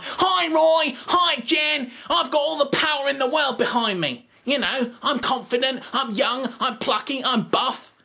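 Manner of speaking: plucky, bursting with confidence; calling out to Roy and Jen in a high, camp voice; bad Moss impression. Shown as a demonstration. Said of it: "so that you can say things like".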